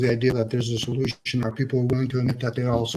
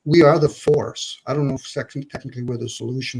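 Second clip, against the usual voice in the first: ordinary delivery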